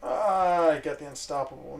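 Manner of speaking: ordinary delivery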